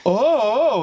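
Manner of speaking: high, loud yelps of a scared guy; a hammed-up frightened "oh, oh"; mock panic. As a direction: imitate someone freaking out. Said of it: "for real freaking out".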